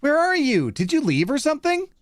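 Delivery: high-pitched, put-on girlfriend voice; girlfriend's voice. Girlfriend texting boyfriend who left, high-pitched questioning. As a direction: read her text in a high questioning voice. Acts girlfriend's text messages.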